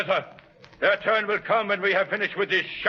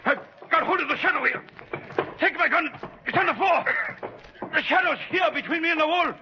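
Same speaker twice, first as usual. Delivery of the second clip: higher, urgent shouted orders; frantic yelling; 9 semitones higher than usual. Acted. Richter, grappling with the Shadow, yells frantically at Ivan. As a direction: bark orders while wrestling an invisible foe.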